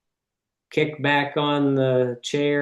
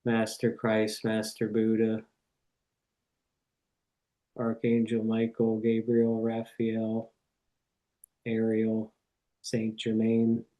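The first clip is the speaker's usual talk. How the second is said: reverent prayer; 2 semitones lower, 4 dB quieter than usual. Real, not acted. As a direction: pray unhurriedly, phrase by phrase, settling into a hush. Leads an opening prayer.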